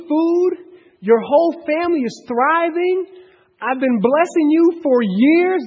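as Joseph, stunned his brothers still doubt him; exasperated Joseph. Retold or imitated. Joseph voiced answering lying brothers.

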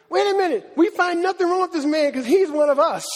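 high, insistent mock objection; sharp contention. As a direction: argue it as a Pharisee defending his own. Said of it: "contend sharply".

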